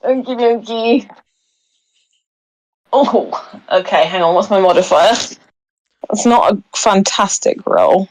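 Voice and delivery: ordinary talk, nothing marked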